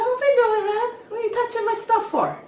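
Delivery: high-pitched playful telling-off; mock scolding. Hairdresser messing with a girl whose foot is on the station, mock scolding. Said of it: "just to mess with her".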